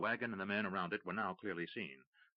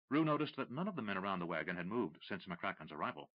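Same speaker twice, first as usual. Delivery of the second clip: ordinary delivery, nothing marked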